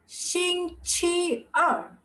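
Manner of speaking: 星期二 very slow and high, syllable by syllable; careful demonstration. Shown as a demonstration. Teacher answers what Tuesday will be, slow careful demonstration. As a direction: draw out 星期二 in separate held syllables. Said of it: "then what will be Tuesday?".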